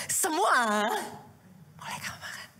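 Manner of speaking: starts aloud, then drops to a whisper; hushed tease to a child. Retold or imitated. Pastor voicing a parent testing a little child, whispering. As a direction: whisper the tempting permission to a child.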